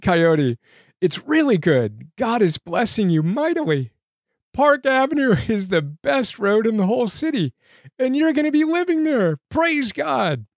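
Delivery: high, overjoyed and close to tears; very high, choked-up rejoicing; nearly weeping. Real, not acted. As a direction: praise pouring out through real emotion. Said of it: "I read it nearly weeping".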